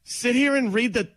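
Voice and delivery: indignant shout. Retold or imitated. Acted quote with shout.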